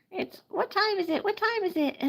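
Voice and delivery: high teasing question; high playful. Real, not acted. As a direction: ask it lightly up high. Asking time, playful.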